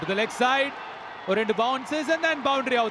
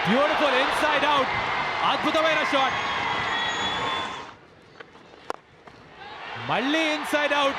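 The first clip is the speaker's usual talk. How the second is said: excited cricket commentary; 3 semitones higher than usual. Real, not acted. Live six commentary.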